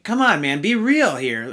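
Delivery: usual speaking voice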